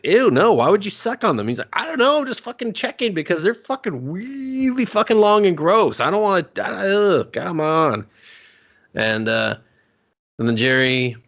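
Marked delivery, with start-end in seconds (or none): 0.0-1.5 high, loud-starting exaggerated revulsion; appalled mock disgust. Jerry answering the finger-sucking question, mock appalled. 1.7-8.0 high-pitched, hammed-up squirming protest; flustered mock disgust. Ed protesting about long gross fingers, flustered disgust.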